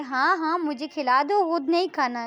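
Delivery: loud, playfully bossy reply; one friend teasing the other about feeding her; teasing retort. Acted. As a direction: tease her and send her off.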